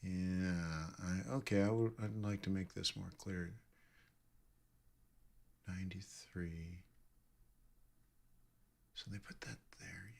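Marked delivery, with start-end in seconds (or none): 5.7-10.1 barely voiced whisper while reading the chart; whisper to self. Real, not acted. Trader reading his chart to himself, whispering. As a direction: whisper the numbers under your breath.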